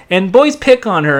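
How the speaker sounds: normal talking voice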